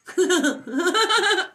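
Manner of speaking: high, loud burst of laughter. Real, not acted. Streamer laughing at her own acted outburst, loud laughter. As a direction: crack up at your own joke.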